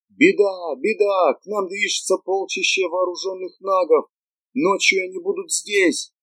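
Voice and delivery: high, urgent cry of alarm; alarmed messenger. Acted. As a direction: call out the bad news in panic. Messenger brings alarming news.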